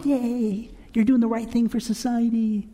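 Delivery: high, half-hearted cheerleader cheer; mock cheering. Host imagines people cheering on the patients, mock cheering. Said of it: "they'll have people cheering on".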